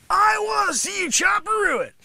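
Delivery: high, loud sensei bark; coaching shout. Acted. Sensei yelling at Piston Honda to chop the boards. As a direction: fire up the fighter with it.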